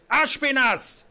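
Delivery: sharp loud call for a servant. Acted. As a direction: shout the name across the hall. King calls his servant. (lauter Ruf)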